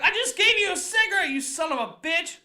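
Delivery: high, loud indignant yell; mock outrage. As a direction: explode at an ungrateful beggar, hammed up. Acted outburst at ungrateful man.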